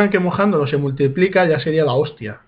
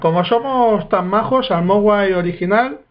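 usual speaking voice

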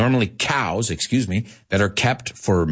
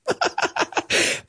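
airy laugh. Real, not acted. Laughs at own joke.

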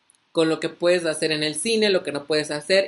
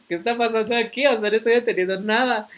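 higher-pitched, panicky string of questions; mock panic; 5 semitones higher, faster than usual. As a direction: act out bewildered panic, lightly hammed-up. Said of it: "todo paniqueado".

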